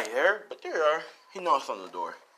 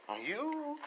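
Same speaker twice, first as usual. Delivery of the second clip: high, soft, drawn-out teasing question; teasing insinuation; 12 semitones higher, 10 dB quieter than usual. Acted. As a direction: tease them with a lifted, suggestive voice. Visitor teasingly probing the pair.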